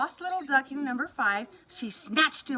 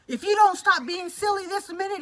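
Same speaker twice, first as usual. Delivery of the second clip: louder higher wolf threat; mild roar; 7 semitones higher, 5 dB louder than usual. Acted. Wolf scolding the duckling, mild mock roar. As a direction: roar it half-heartedly. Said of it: "he roared".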